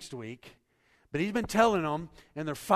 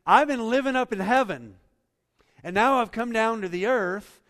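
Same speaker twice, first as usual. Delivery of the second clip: voicing Jesus; 6 semitones higher, 9 dB louder than usual. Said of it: "Jesus is saying".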